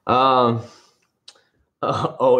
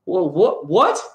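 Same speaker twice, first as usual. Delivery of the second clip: higher, rising baffled what; puzzled rising what; mock confusion; 8 semitones higher, 3 dB louder, much slower than usual. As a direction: act confused. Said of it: "I'm like".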